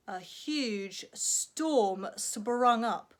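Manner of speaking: slow, drawn-out build on storm; dramatic storytelling. Acted. Storyteller building drama for children, slow and big. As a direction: stretch it out like a storm rising.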